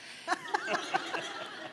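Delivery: airy high giggle without voice; high breathy laugh. Real, not acted. Laughing at her own story.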